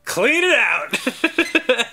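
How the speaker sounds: high, very slow gloating; slow gleeful villain. Pretend. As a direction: savor it like a cartoon crook.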